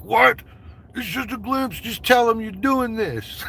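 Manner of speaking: high-pitched, incredulous retold outburst; mock exasperation. Acts out his exasperated reaction.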